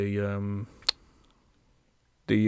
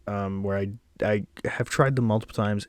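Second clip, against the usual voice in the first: ordinary talk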